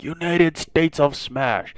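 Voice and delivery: calling a move that fails; understated hero attack call; first attack call. Retold or imitated. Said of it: "And he's like".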